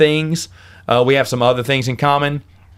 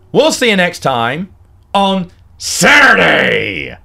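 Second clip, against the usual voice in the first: drawn-out outro shout; 5 semitones higher, slower than usual. Real, not acted. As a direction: belt out the sign-off slowly. Signature outro call.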